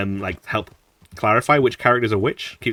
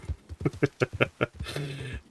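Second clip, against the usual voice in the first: soft, high chuckle; laughing; 5 semitones higher, 9 dB quieter than usual. Real, not acted. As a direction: chuckle quietly after your own joke. Laughs at own quote.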